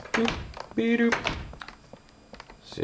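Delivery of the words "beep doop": high, odd-toned beep doop; silly high robot beep noises. Pretend. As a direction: chirp like a little computer. Imitating console beeps.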